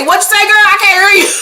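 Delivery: high-pitched call over music; mock can't-hear. [name] answering over the music, mock can't-hear.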